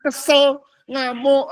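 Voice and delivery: high-pitched, amused listing; laughing. Real, not acted. Preacher listing heart feelings to listeners, laughing. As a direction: chuckle through each word.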